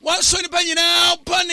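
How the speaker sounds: slow, loud, high shouted proclamation; preaching at full force. Real, not acted. Preacher proclaiming to listeners, slow and shouted. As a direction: draw out every word and shout it.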